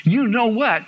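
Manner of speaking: retold excitement; excited. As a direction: burst out with a new idea. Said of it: "I got so excited".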